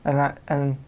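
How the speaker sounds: ordinary talk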